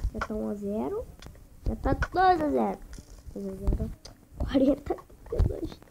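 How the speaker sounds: ordinary talk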